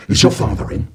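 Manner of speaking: low gruff question; grumpy neighbour. Acted. Downstairs man asking boy, low gruff question. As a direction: loom over the boy. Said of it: "he started to speak".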